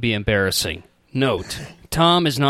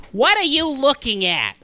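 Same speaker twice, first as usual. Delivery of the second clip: mom voice; 12 semitones higher than usual. Acted. Mom line, second run.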